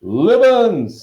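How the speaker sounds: high, loud exclamation; boisterous ghost huntsman; fake astonishment. A narrator's reading. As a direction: hail them with exaggerated surprise. Sir Patrick's fake astonishment.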